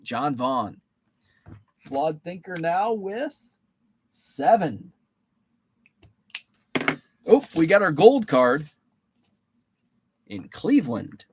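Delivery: usual speaking voice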